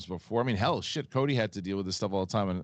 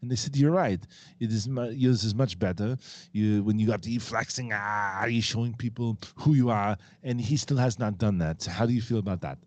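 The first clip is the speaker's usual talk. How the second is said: put-on Arnold-style Austrian accent; 2 semitones higher than usual. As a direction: do an Arnold accent, picking a quarrel. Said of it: "like to hear Arnold and the Flirtenflaus".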